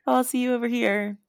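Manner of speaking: smiley polite acknowledgement. Acted. Brushing past people with a smile, put-on high friendliness. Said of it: "the smile".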